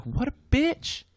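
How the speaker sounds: a hammed-up sneer about the lady; scornful quote. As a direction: stretch it out like gossiping parents sneering. Retelling other parents' disgusted reaction.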